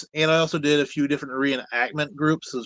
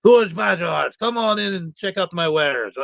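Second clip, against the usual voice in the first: hammed-up dwarf with a bad Scottish accent, higher; 4 semitones higher than usual. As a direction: play a jolly tavern dwarf in a cheesy Scottish accent. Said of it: "or a very bad Scottish accent".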